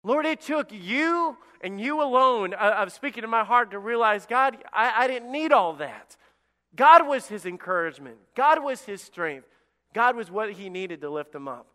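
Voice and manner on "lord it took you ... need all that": raised, fervent prayer; impassioned realization. Acted. David realizing he needed God alone, fervent. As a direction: pour it out high to God.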